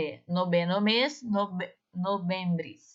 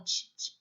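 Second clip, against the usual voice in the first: whispered tch tch; tch sound; 7 dB quieter than usual. Shown as a demonstration. Teacher shows students the banned tch sound. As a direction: click out tch tch softly. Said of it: "não faça esse som".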